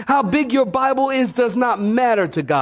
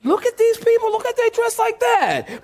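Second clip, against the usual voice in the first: shocked newcomer; 11 semitones higher than usual. Retold or imitated. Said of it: "I'm like".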